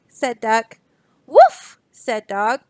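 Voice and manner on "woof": high, loud barked woof. Acted. Dog answers Duck with a high, loud woof.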